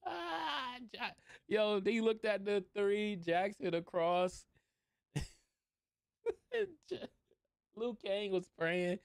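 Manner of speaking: real laughter squeezing the voice high; cracking up. Real, not acted. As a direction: struggle to tell it through helpless laughing. Cracking up while retelling the scene.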